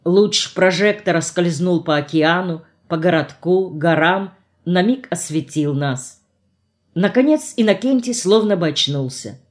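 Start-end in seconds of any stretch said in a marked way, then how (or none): none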